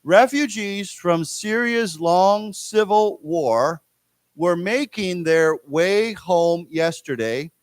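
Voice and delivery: slow, word-by-word article reading; reading aloud to class. Shown as a demonstration. Teacher reading an article to students, slow careful read-aloud. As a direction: read it out slowly for learners, in careful phrases. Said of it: "read this article".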